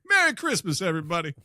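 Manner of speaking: high, loud movie-quote shout; high excited shout. Retold or imitated. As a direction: belt it out like a giddy movie hero. Quoting It's a Wonderful Life.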